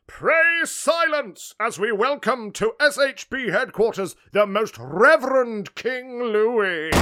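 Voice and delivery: loud herald's proclamation; ceremonial announcer. Acted. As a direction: proclaim the guest to the whole hall. Announces the next king.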